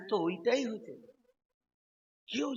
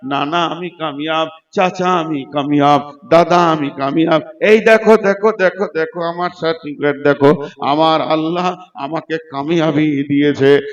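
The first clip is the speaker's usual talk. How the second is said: acted jubilant calls, punched out loudly; joyful shouting; 22 dB louder than usual. Saved man showing relatives his certificate, acted joyful calling. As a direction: boast it to relative after relative. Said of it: "আনন্দে লাফাবে".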